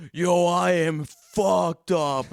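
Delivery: loud, heavily drawn-out words; imitated wasted announcement. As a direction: announce it like a wasted friend arriving. Acts out wasted friend's entrance.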